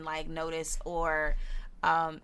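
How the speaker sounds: ordinary talk, nothing marked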